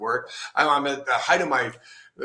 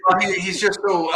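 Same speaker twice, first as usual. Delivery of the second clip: much higher, squeaky overwhelm; 7 semitones higher, 5 dB louder than usual. Real, not acted. Explaining why he turned back from his idol, flustered squeak. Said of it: "I'm overwhelmed".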